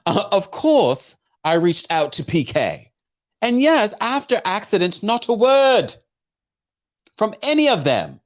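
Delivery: lightly put-on English accent. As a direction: quote her in an English accent. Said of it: "put on an English accent".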